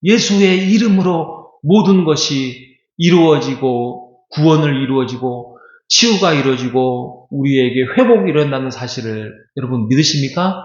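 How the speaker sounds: ordinary delivery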